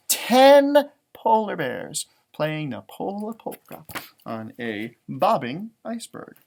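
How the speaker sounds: slow, clear picture book reading; storybook read-aloud voice; reading to children. Acted. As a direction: read it out slowly for little listeners. Reads book text to children.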